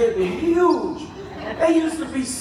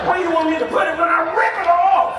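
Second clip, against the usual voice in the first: mock tough shout; 6 semitones higher, 3 dB louder than usual. Mock strongman at emergency door.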